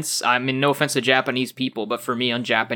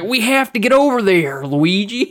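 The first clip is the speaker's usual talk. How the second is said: mock Southern accent; 7 semitones higher, 4 dB louder than usual. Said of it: "Southern accent".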